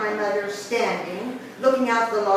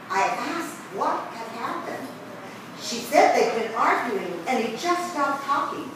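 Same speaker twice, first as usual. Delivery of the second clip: ordinary delivery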